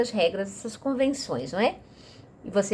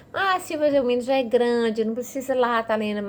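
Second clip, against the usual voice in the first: pitched-up, dismissive parent brushing off the idea; mock parent objection; 4 semitones higher than usual. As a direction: play the parent who thinks reading aloud is unnecessary. (imitando um pai que despreza a ideia)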